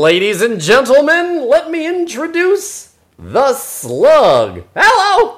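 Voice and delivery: high, loud, slow showman slug; goofy slug voice. Pretend. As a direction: announce it like a cartoon slug emcee. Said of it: "这个声音就是我想象中这个slug的声音".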